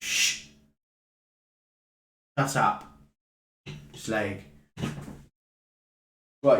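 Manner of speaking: a scary boss laying down the law to a cheeky customer; menacing hardman boss. Acted. Second character confronting, held voice.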